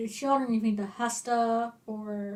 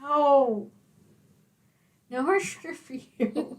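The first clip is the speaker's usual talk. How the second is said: mock scolding parent; 4 semitones higher, 5 dB louder, slower than usual. Teasing scolding of friend.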